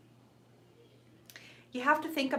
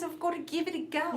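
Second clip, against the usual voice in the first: higher mock Australian voice; Australian accent; 6 semitones higher than usual. Imitating the Australian viewer, Australian accent. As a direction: imitate an Aussie, pitched up. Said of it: "She's in Australia, darling".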